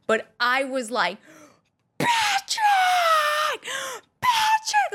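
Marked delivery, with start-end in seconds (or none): 2.0-4.9 very high fangirl scream; screaming his name. Retold or imitated. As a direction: shriek his name across the lot. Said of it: "like, screaming".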